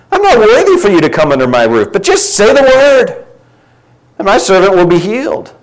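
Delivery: a soldier begging, reaching up in earnest; humble centurion pleading. Acted. Preacher voicing the centurion to Jesus, pleading and high. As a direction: voice climbing high on "just say the word", slowed.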